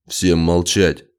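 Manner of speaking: slow, low, forceful order; stern, weighty command. A narrator's reading. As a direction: order everyone to be silent. Urgent order for silence.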